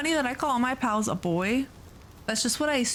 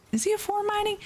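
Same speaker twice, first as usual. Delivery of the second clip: surprised question; 13 semitones higher, faster than usual. Real, not acted. Double-checks her Anubi's mining level.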